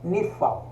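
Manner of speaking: nifal said as nee-FAHL, stress on the last syllable, softly; name nifal clearly, stress on FAL; quiet demonstration of nifal; Hebrew term nifal. Shown as a demonstration. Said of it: "Então ficaria assim, ó".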